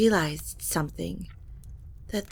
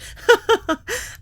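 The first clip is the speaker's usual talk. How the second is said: high, loud forced nervous laugh; 9 dB louder than usual. Acted. Lilibet laughing nervously to her mother.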